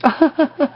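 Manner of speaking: high-pitched giggle; short high burst of laughter. Real, not acted. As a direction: giggle at your own naughty joke. Laughs at own joke.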